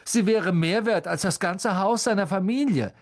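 high-pitched scornful jibe; taunting bully; mocking. A narrator's reading. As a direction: land the insult with a sneer. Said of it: "spottete Malfoy".

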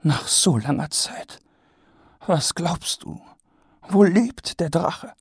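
high, wondering questions; Lady Ramkin musing on the dragon; eager lady. A narrator's reading. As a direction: ask dreamily.

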